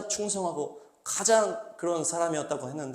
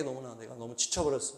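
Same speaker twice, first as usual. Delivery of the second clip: weary sigh; 5 semitones lower, 10 dB quieter than usual. Retold or imitated. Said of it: "짜증나가지고".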